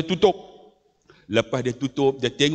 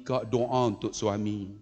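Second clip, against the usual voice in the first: ordinary delivery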